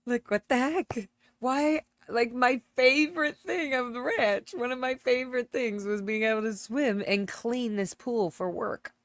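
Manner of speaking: rising outrage at the missing pool; excited dismay. Real, not acted. Finding the pool gone.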